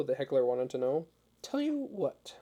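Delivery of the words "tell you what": pitched-up, put-on American accent; a lightly voiced young American missionary; heavy American accent. A narrator's reading. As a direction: lean into a broad American accent. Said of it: "in a heavy American accent".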